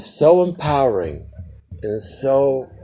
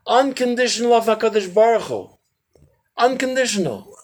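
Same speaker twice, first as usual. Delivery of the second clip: fervent; 7 semitones higher, 5 dB louder than usual. Real, not acted. Preaching climax.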